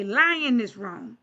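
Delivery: ordinary talk, nothing marked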